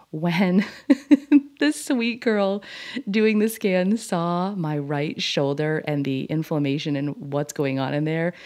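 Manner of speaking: normal talking voice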